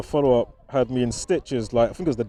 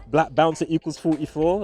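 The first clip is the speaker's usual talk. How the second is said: usual speaking voice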